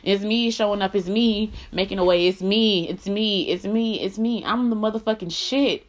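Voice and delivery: emphatic bragging. Real, not acted. Woman tired of being humble, bragging emphatically. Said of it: "I'm about to brag on me".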